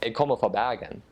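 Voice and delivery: proud Western Norwegian Bergen dialect, R back in the throat. Shown as a demonstration. Guest showing a proud Bergen local's Bergen dialect. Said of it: "if you are actually from Bergen".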